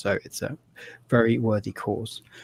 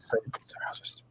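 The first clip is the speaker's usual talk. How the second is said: quick whispered aside, faster; hurried whisper; 13 dB quieter than usual. Real, not acted. Host whispering an aside while closing YouTube. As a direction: rush it under your breath.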